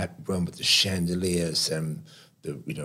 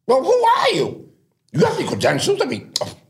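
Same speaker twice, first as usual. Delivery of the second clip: very high, loud imperious imitation; hammed-up haughty challenge, shouted high; Andre Leon Talley; 8 dB louder than usual. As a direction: imitate a grand figure's outraged dismissal. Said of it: "And he says".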